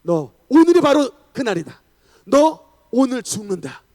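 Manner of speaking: forceful devil pronouncing doom; Satan's threat. Retold or imitated. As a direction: proclaim the doom loudly, punching each phrase. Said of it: "사탄이 와가지고 혜긴 목사님에게도 말을 했는데".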